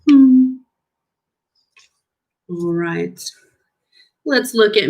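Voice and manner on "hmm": a loud sulky humming grunt; pouty hmm. Shown as a demonstration. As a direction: hum like a sulking child. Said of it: "We go".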